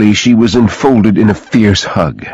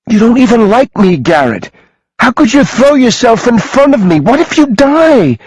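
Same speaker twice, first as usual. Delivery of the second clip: much higher, frantic tearful yell; 3 dB louder than usual. A narrator's reading. Woman yells at Garrett, afraid he'll die. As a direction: plead and scold him at once. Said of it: "she yelled".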